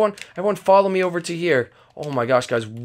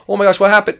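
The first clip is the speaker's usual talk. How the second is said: normal talking voice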